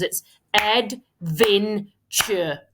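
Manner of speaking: adventure stretched very slowly, syllable by syllable; sounding out words. Shown as a demonstration. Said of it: "let's break it into syllables".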